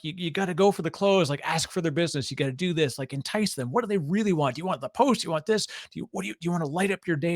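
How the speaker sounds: high, urgent sales coaching; schooling salesperson. Retold or imitated. Movie salesperson coaching the caller to close, acted schooling. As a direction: imitate an aggressive salesperson schooling a rookie. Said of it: "schooling them".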